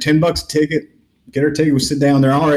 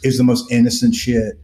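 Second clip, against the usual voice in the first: ordinary talk, nothing marked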